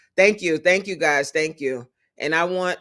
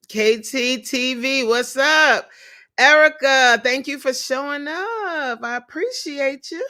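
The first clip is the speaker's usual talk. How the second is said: 1 excited greeting; 8 semitones higher, 4 dB louder than usual. Real, not acted. Greeting chat viewers excitedly.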